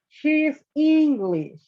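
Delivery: teacher dictating the example; slow read-aloud. Shown as a demonstration. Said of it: "teacher reads the example sentence".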